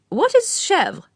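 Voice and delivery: put-on high, fluttery elderly aristocrat; old lady's voice. A narrator's reading. Lady Mondor asks.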